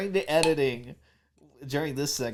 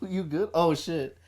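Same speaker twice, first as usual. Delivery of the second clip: ordinary talk, nothing marked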